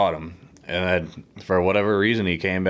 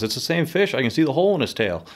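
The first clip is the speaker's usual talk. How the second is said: much higher, fast, excited retelling of Charlie; 8 semitones higher than usual. Retold or imitated. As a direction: blurt it out as if spotting the fish. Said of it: "Charlie goes".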